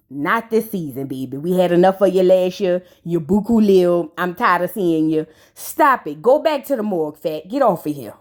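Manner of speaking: low, dismissive brush-off. Acted. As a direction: wave her off the show, low and unimpressed. Dismissing Shakana directly.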